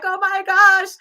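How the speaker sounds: high, loud overdone despair; mock heartbroken wail. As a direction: wail it like a fool in love.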